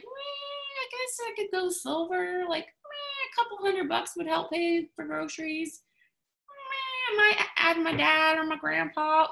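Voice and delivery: high, bored, whiny mock voice; unmotivated whine. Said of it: "you're just sitting there and you're like".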